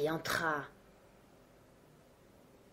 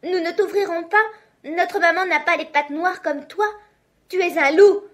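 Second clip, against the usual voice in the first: put-on much higher and louder kids' shout; kids shouting; 9 semitones higher, 7 dB louder than usual. A narrator's reading. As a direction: yell it at the wolf. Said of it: "crièrent". (les chevreaux crient, beaucoup plus aigu et fort)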